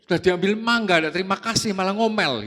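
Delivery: yelling from the bathroom at his wife, indignant. Retold or imitated. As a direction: shout back at the nagging wife. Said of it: "langsung teriak".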